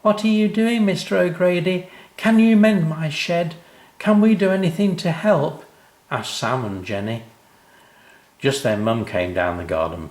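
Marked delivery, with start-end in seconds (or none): 0.0-5.6 put-on high, loud excited kids' voices; eager children. Sam and Jenny pester O'Grady, high and eager. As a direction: ask eagerly like small children.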